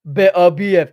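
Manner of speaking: "Better B F" in a London accent, loud; London accent, dropped consonants. Real, not acted. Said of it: "it's my London accent".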